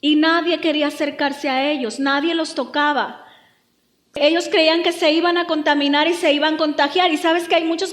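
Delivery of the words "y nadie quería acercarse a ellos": usual speaking voice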